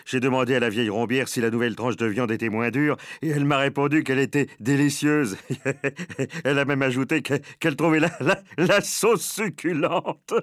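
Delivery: chuckling put-on server voice; gleeful mocking. A narrator's reading. Server mocking an old customer to colleagues, gleeful. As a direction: tell it breaking into laughter, drawing out the punchline.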